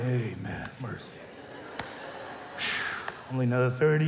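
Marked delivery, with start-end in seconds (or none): 0.0-1.2 mock weary amen, lower and soft; a hint of exhausted churchgoers; tired married people. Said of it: "see how tired they sound". 1.5-3.3 long tired mock sigh; weary sigh. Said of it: "how tired they sound".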